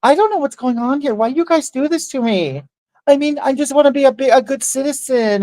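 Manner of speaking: sarcastic imitation of a neighbor faking innocence on camera; mock innocent victim. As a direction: play the helpless innocent for the camera.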